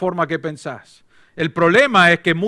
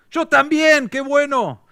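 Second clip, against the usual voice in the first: higher, eager mock delight; 8 semitones higher, slower than usual. Rescuer delighted the jumper shares his church, gushing. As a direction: gush with cheerful glee.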